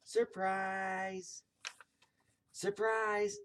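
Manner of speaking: high, drawn-out cheer; long, high party shout; shouted surprise. A narrator's reading. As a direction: call out a big surprise, pitched well up. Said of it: "all the ladies shouted".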